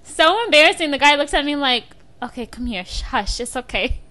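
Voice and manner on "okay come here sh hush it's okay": softer, calm hushing; soothing hush. Retold or imitated. The guy calming her after the locked-door mix-up, softly. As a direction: settle her down gently.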